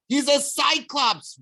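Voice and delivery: high, slow urgent warning. A narrator's reading. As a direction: warn him anxiously. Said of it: "Grover warned".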